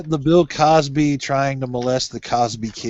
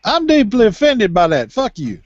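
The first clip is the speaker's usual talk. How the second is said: mock offended; 7 semitones higher, 4 dB louder than usual. Mock offense high pitched.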